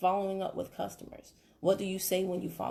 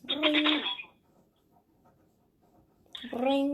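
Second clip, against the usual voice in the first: phone ring; 6 semitones higher than usual. Pretend. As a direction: play the phone ringing. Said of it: "The phone rings".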